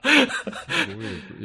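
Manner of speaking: slow, high laughing echo. Real, not acted. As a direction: drag it out through laughter. Incredulous echo through laughter. (笑いながら引き伸ばして)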